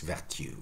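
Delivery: "vertu" as ver-TYOO; "vertu" with a y-glide before u; Middle English pronunciation. Shown as a demonstration. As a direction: say "vertu" as Chaucer would, r sounded. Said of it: "this would be Chaucer".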